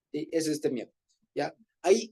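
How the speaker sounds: ordinary talk, nothing marked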